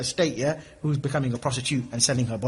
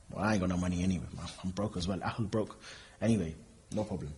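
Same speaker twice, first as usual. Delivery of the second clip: low mumbled reply; 9 semitones lower, 12 dB quieter than usual. Retold or imitated. Friend's dry reply voiced.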